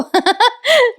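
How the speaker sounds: high burst of strange laughter; odd overjoyed laugh. A narrator's reading. Jeeva hugging Nandini, laughing in a strange high voice. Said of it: "वेगळ्याच आवाजात हसतोय तो".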